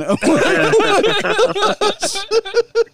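high, loud burst of laughter; real cackle. Real, not acted. Laughing at the joke.

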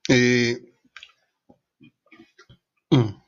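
talking between sips; mid-sip throat noises; while sipping a drink. Real, not acted. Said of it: "estoy tomando algo para la garganta". (tomando un trago)

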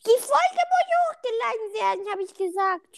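put-on high, insistent baby voice. Podcaster demanding the episode be uploaded, baby voice. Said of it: "Lass mit deiner Babystimme reden".

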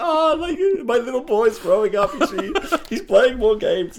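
high, loud mock-proud father gushing; proud dad. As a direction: gush like a sappy proud dad. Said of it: "like, proud dad moment".

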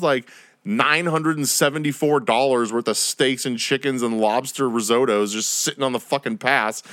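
normal talking voice

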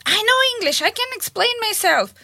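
high, indignant retort; upset protest. Retold or imitated. Russian lady rebuffing the offer to interpret, high upset protest. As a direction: imitate an offended lady insisting she can manage. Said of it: "she gets very upset".

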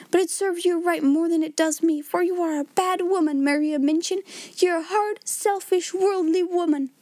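high-pitched hysterical accusation; wild tirade. A narrator's reading. Miss Amelia denounces her sister, wild high tirade. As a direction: keep the voice high as the rant pours out. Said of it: "she cried wildly".

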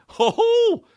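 very high hooting laugh; mock buddy laugh. Imitating old roommate's laugh, high hoot. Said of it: "He would laugh like that".

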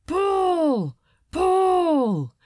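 loud, high chant of Pull; haul-together shout from the crew; shouted command. Acted. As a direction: sailors yelling as they heave on the winches. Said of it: "men cried".

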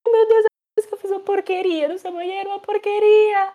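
imitating Vera confessing the mess she made in the bathroom; squealing foreign woman. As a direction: overact the embarrassment. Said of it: "a Viera".

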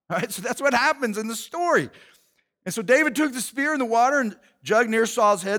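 ordinary talk, nothing marked

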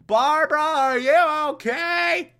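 high, drawn-out mock Batman call. Batman calls through the door to Barbara, mock-concerned call.

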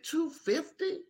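high, soft, slow timid question; barely daring to ask the price; scared. Retold or imitated. Leatherworker retelling his nervous quote, high and timid. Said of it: "I was so afraid".

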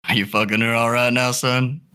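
put-on improvised girlfriend's dad with an uncertain country accent; lightly put-on rural accent. Dad questioning his daughter's boyfriend, in a put-on country accent. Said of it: "no idea what this accent is".

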